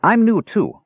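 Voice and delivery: eager kender. Acted. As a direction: pipe up, wanting to be noticed.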